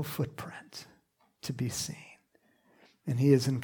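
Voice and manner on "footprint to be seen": hushed whisper. Acted. Preacher to congregation, awed whisper.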